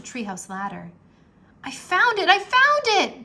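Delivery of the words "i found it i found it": high, loud excited shout; boy cries out finding the chest; excited cry. A narrator's reading. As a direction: shout with joy at the find. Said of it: "cried Jack".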